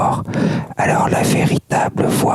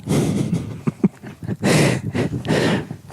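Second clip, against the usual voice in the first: whispered laugh; 4 dB quieter than usual. Real, not acted. Whispered chat, breathy laugh.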